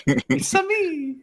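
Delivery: high, slow Mario impression; hammed-up "It's a me" Mario.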